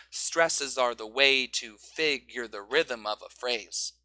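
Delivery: slow, with "way" stressed on each finger count; finger-counted stresses. Shown as a demonstration. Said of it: "use their fingers".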